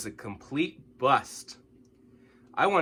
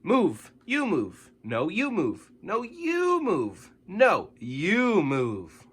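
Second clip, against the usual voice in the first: escalating "you move" argument, higher and slower; squabbling roommates; 6 semitones higher, 4 dB louder, much slower than usual. A narrator's reading. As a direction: bicker back and forth like crowded roommates. Roommates bickering over space.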